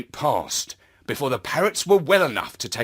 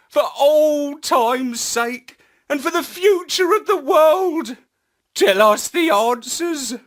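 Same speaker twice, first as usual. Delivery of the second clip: much higher, louder begging; pleading Astropup; 11 semitones higher, 5 dB louder than usual. A narrator's reading. Astropup begging his parrot friend, higher and louder. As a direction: beg the old friend, pitch up and pushing.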